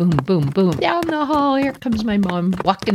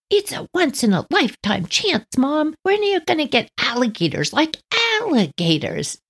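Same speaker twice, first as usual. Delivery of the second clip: put-on eager kid excuse; 10 semitones higher than usual. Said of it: "son answers mother".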